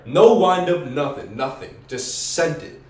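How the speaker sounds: loud, excited boasting; hyped-up bragging. Real, not acted. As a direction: brag about the knockout. Excited punch climax.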